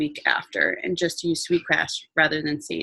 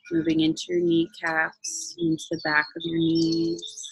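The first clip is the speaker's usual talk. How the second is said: guided meditation; 4 dB quieter than usual. Acted.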